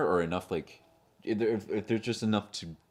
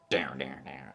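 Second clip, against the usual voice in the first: low sung-out suspense sting; imitated horror sting; 6 semitones lower, 4 dB quieter, slower than usual. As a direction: mimic an ominous movie music cue, low. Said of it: "they had a musical sting".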